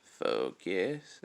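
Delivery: normal talking voice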